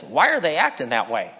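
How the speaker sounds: a curious little rabbit puzzling over the grown-ups; preacher lightly voicing Thumper's puzzled question. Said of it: "Thumper says".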